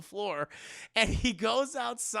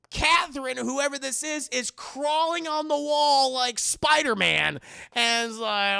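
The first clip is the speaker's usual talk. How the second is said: ordinary talk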